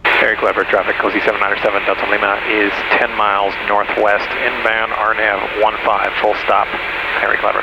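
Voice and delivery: low, level radio-call voice; pilot radio call. Real, not acted. Pilot reporting inbound to Harry Clever traffic, low radio-call voice. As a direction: announce your position in a low pilot's monotone.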